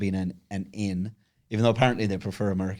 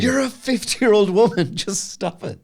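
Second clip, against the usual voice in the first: exasperated scolding; 10 semitones higher, 5 dB louder than usual. Retold or imitated. Scolding the pretend child.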